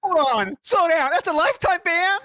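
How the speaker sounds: surprised. Real, not acted. Shocked at the lifetime ban.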